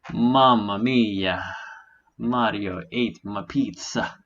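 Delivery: slow hammy Italian pizza chef; Italian accent. Pretend. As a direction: mamma mia, like a cartoon Italian. Mock Italian after losing trade.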